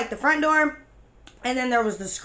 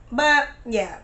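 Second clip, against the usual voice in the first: usual speaking voice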